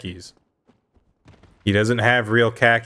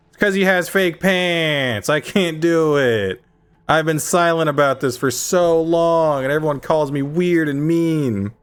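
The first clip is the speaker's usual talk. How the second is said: passionate rant; 5 semitones higher than usual. Real, not acted. Ranting to his friends about the fake khakis, fired up. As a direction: vent the grudge with mounting heat. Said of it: "You're so passionate".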